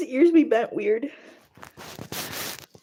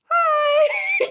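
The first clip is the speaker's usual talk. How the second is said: squeaky hi. Real, not acted. Squeaky greeting aside.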